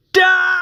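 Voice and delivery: high, loud effort scream; a hammed-up hero's scream. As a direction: let out a straining battle cry. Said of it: "Barry screams".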